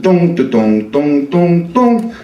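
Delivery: sung ringtone rising in pitch; mimicked cell phone melody climbing up; ringtone imitation. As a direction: chime it like a cell phone ring. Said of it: "all of a sudden".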